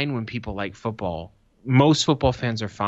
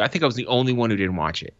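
ordinary talk, nothing marked

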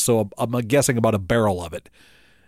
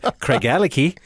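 Craigellachie with a light Scottish lilt, Craigellachie said the Scottish way; a touch of Scottish accent on Craigellachie. Shown as a demonstration. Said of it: "You can't help but not say that in a Scottish accent".

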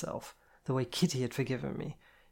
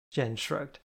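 ordinary delivery

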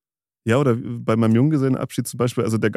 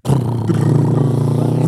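spoken drumroll; 4 dB louder, much slower than usual. Acted. Spoken drumroll during announcement.

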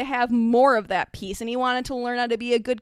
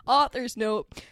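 usual speaking voice

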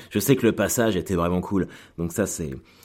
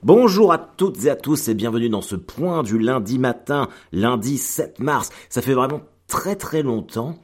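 show intro; 5 semitones higher, 4 dB louder than usual. Acted. Opens the Monday morning show.

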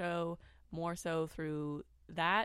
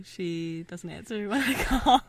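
laughing through the words; half-laughing complaint; 10 dB louder, slower than usual. Real, not acted. Laughing about voicemail.

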